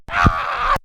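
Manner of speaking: high shouted ah of delight; a wild, high yell of delight; joyous scream. Retold or imitated. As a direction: let out a shriek of triumph. A scream of joy at the win.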